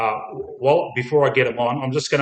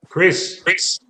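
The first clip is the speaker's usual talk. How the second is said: raised voice calling a name across the room; 6 semitones higher, 5 dB louder than usual. Real, not acted. Host calling a late guest into the chat, loud call. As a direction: call out loud to get his attention.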